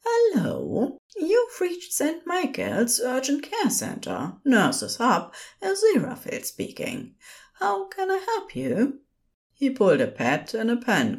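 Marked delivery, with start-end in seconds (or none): 0.0-9.0 high, polite phone-line greeting; put-on high customer service voice; patient service voice. A narrator's reading. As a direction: answer the helpline in a higher, patient voice. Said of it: "putting on his patient service voice".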